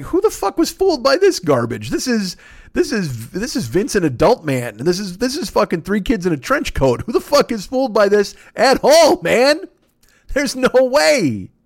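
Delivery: high-pitched, outraged rant; worked-up, high cry of disbelief; incredulous yelling. Real, not acted. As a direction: yell it high, in total disbelief. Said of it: "don't yell".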